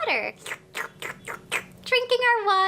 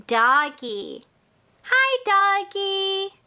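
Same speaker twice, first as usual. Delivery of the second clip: normal talking voice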